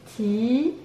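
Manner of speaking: "Tipi" as drawn-out ti, pause, pi; syllable by syllable. Shown as a demonstration. Teacher recounting, "Tipi" split into ti, pi. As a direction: separate the two halves of "Tipi". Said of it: "Deux syllabes".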